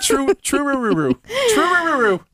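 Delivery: high, tangled "roo, roo"; flustered stumbling. Real, not acted. Stumbling tongue twister.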